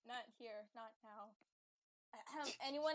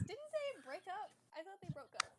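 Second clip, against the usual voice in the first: usual speaking voice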